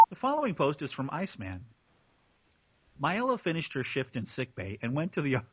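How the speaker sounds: low, measured narrator voice; story narration. Acted. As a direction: read it out as a composed narrator. Reads the post as narrator.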